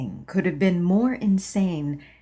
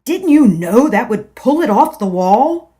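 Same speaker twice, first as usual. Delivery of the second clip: louder, scolding yell; husband yelling; 4 semitones higher, 10 dB louder than usual. Acted. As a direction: snap at her angrily. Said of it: "he had yelled".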